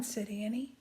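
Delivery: usual speaking voice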